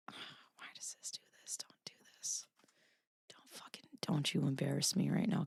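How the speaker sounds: hushed, voiceless threats at the computer; whispered scolding. Real, not acted. Muttering at a glitching computer in a whisper. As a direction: hiss it under your breath.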